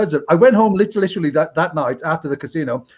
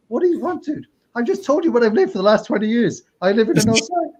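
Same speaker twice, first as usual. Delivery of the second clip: very high, pleading mock frustration; exasperated protest; 8 semitones higher than usual. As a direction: protest like you're fed up, voice pitched way up. Retells exasperation at repeated questioning.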